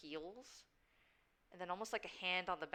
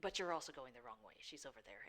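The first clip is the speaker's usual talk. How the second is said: low, soft shadow voice; dark whisper behind you; 6 semitones lower, 6 dB quieter than usual. Acted. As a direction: guide them from the dark, low. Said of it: "it's the Shadow's voice".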